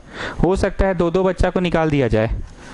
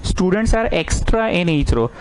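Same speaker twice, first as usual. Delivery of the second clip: very slow, spaced dictation; teacher dictating the problem to students, very slow; 3 semitones higher, much slower than usual. Acted. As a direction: read it out slowly so everyone can write.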